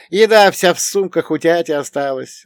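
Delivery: high, loud, mildly despairing lament; crushed voice. A narrator's reading. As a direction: lament it as the downcast companion. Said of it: "убитым голосом закончил он".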